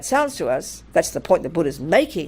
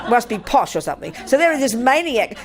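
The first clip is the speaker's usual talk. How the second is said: ordinary delivery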